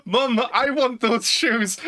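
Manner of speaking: put-on whiny kid. A kid begging his mum for shoes, slow and whiny. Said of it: "And it says".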